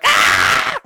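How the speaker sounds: full-force mock scream; loud, sudden horror-audience shriek. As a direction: scream at the top of your lungs. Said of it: "it was louder than that".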